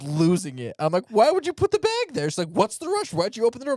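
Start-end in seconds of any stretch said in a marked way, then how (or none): 1.1-2.3 exasperated yell. Retold or imitated. Retold parking garage spat. 2.5-3.7 indignant retort. Retold or imitated. Wife's retort in retold spat.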